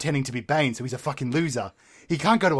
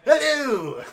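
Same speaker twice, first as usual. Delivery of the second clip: Joker voice; 7 semitones higher than usual. Acted. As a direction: cackle out a crazed yes. Joker greeting at door.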